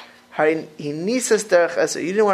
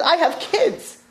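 exasperated retort; 12 semitones higher, slower than usual. Retold or imitated. Yaakov's reply retold.

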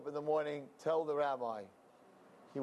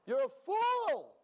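much higher, louder, slow contemptuous snap; scolding; 4 dB louder, slower than usual. Acted. Rabbi throwing the simple man out of the shul, loud scorn. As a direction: spit out the insult.

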